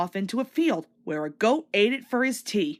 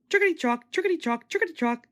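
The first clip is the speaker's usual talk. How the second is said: higher rhythmic clacking chant; coaches rattling; 7 semitones higher, faster than usual. A narrator's reading. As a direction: chant it like wheels on rails. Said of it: "said the coaches".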